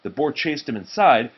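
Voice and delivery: usual speaking voice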